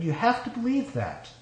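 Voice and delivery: high, emotional declaration; exclaiming. A narrator's reading. Bride answering her returned love, high exclamation. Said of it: "she exclaimed".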